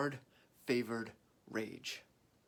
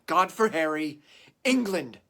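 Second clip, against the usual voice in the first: loud, high rallying shout; battle cry; 5 semitones higher, 12 dB louder than usual. Acted. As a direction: cry it out to charge the troops. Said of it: "upon this charge cry".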